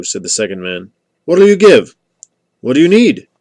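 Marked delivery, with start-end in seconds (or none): 1.2-1.9 high, loud bargaining call; raised voice, pitched well up. A narrator's reading. Lady bargaining across a dark hillside. 2.6-3.3 loud, high question thrown across a distance; raised bargaining call. A narrator's reading. Traders calling across a dark hillside.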